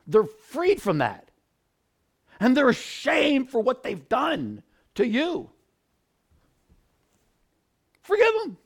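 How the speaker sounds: high, loud pleading sermon; fervent preaching. Acted. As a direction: urge them with passion. Fervent appeal to forgive.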